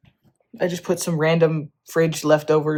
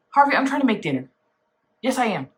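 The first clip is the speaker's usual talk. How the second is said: talking to pet; 6 semitones higher, faster than usual. Real, not acted. Talking to the cat.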